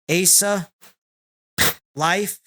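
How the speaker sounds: ordinary talk, nothing marked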